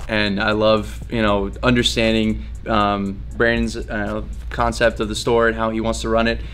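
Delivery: ordinary talk, nothing marked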